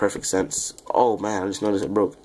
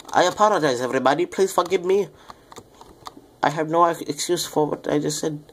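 apology pitched up high; higher apology; 8 semitones higher than usual. Real, not acted. Apologizing to viewers, voice pitched up high. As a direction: raise the voice well up to apologize. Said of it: "I'm talking in a low tone".

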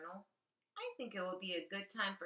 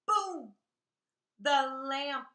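loud; 7 semitones higher, 10 dB louder, slower than usual. A narrator's reading. Smashing furniture sound effects.

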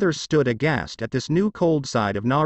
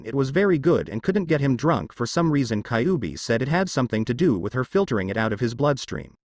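ordinary delivery, nothing marked

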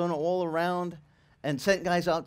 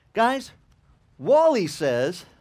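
raised voice, drawn-out words; loud announcement; 3 semitones higher, 7 dB louder, much slower than usual. Retold or imitated. Pastor addressing the men at prayer, loud announcement. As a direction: call out to the whole group slowly.

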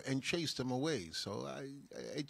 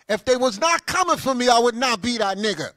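louder emphatic retake; 5 semitones higher, 8 dB louder than usual. Shown as a demonstration. Preacher to his audience, emphatic loud retake. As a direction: hit it harder the second time, loud. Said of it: "refer to myself as".

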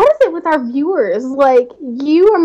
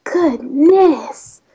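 mock stutter; 5 semitones higher than usual. Stuttering on goodness for fun.

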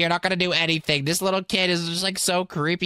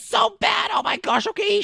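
much higher panicked yelling; panicked shout; 12 semitones higher than usual. Acted. Kid under robot fire, high panicked shout. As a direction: freak out under minigun fire. Robot guard attacking them.

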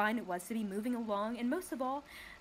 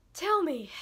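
yawning; 7 semitones higher, 7 dB louder than usual. Acted. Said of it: "he yawned".

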